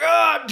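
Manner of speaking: loud, high mock war cry; battle yell. Kicking down the teepee, high loud mock battle cry. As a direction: charge in with a G I Joe yell. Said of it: "I went in there like G I Joe".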